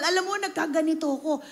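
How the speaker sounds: usual speaking voice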